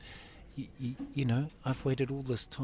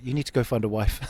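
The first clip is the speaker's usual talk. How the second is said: laughing counsellor; 10 dB louder, faster than usual. Retold or imitated. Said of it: "And I'm like".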